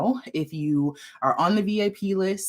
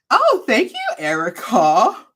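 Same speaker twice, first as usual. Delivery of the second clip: delighted high thanks; 3 semitones higher, 7 dB louder, slower than usual. Real, not acted. Thanking viewer for compliment.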